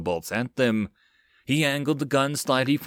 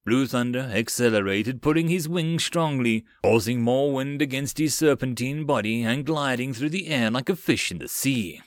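plain reading voice

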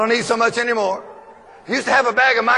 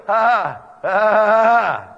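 imitating Sarah's laugh; 5 semitones lower than usual. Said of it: "she started laughing. She's going".

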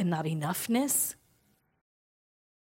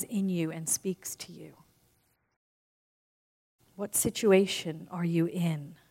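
guided visualization; 2 semitones lower, 3 dB quieter than usual. Acted. Speaker guiding the audience through closing their eyes, softly.

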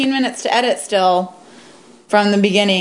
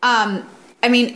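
ordinary delivery

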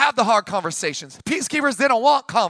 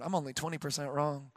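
inner thought; 6 semitones lower, 13 dB quieter than usual. Retold or imitated. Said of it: "In my head, I'm thinking".

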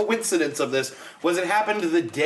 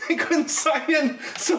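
laughing; 12 semitones higher, slower than usual. Real, not acted. Laughing while telling story.

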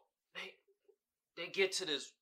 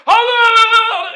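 shrill-pitched called out greeting, much higher and louder; loud high hello; 18 dB louder than usual. Retold or imitated. Lady at crime scene calling out, loud and high. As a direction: sing out hello from across the room.

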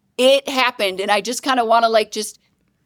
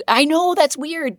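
ordinary talk

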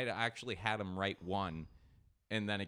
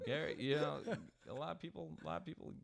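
much higher, dramatic tribute voice; mock eulogy; 11 semitones higher than usual. Host giving a mock tribute to Gary, higher and hammed-up. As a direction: deliver it like a solemn tribute, tongue in cheek.

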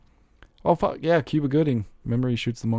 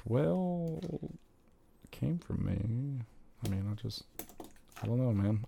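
softer, sheepish mumble; mock mumbling; 2 semitones higher, 9 dB quieter, slower than usual. Retelling what he said to everyone, sheepish mumble. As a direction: trail off into guilty mumbling. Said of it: "And I'm like".

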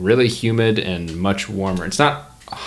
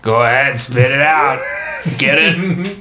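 raised, pushy cat voice; goading cat; 5 semitones higher than usual. Pretend. Game master as a cat urging a stammering player, raised pushy voice. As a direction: egg them on, higher and stronger.

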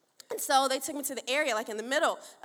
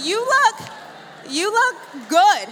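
shouted; 9 semitones higher, 16 dB louder than usual. Shown as a demonstration. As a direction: belt it with big emphasis on look. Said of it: "Tell them, say".

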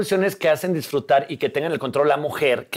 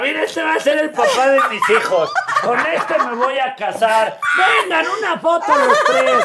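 put-on tipsy party girl; 8 semitones higher than usual. Imitating tipsy self at party.

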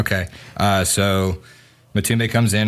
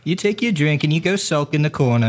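snippy barmaid; 7 semitones higher than usual. Acted. Barmaid snipes back.

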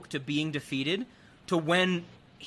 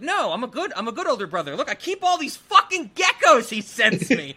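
higher, building to loud exasperation; mock indignation; 7 semitones higher, 7 dB louder than usual. Host acts the brother defending himself, mock indignation. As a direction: protest hotly, on the edge of laughing.

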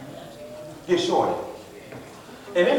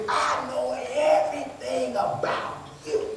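hushed, drawn-out fan awe, pitched up; gushing fan; 4 semitones higher, 6 dB quieter, slower than usual. Acted. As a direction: stretch it out softly like a star-struck fan. Fan gushing to a favourite star.

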